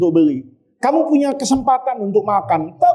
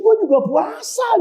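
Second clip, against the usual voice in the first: mock policeman retort; 11 semitones higher than usual.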